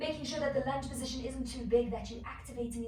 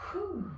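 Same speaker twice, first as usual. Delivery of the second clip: breathy whew exhale; 8 semitones higher than usual. Real, not acted. Exhaling after the flow.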